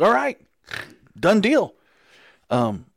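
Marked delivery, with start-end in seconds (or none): 0.0-1.7 excited retold agreement. Retold eager acceptance of deal.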